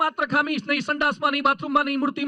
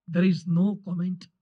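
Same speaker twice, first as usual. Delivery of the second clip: solemn slow words; 7 semitones lower, much slower than usual. Acted. Speaker frames the cartoonist's silence.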